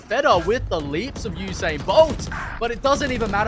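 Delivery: excited play-by-play call; esports caster. Acted. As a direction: ride the rising action. Caster rising with the action.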